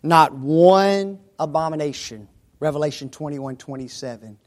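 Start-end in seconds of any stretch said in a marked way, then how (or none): none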